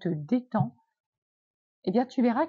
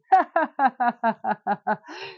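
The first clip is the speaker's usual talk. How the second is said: a deliberately forced, louder laugh; 4 dB louder than usual. Shown as a demonstration. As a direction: push a fake laugh out on purpose. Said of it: "ça va être forcé".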